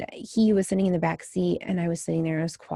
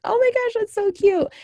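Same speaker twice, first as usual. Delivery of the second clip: very high, louder cooing gush; delighted gush; 5 dB louder than usual. Retold or imitated. Mother watching her daughter play in the rain, gushing. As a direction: squeal over something adorable.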